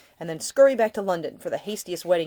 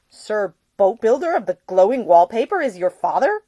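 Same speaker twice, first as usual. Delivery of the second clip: astonished; 4 semitones higher, 10 dB louder than usual. Acted. Nick stunned, exclaiming.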